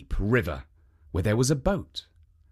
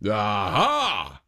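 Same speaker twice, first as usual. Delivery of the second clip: ogre's aha; 4 semitones lower than usual. A narrator's reading. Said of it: "cried the Oni".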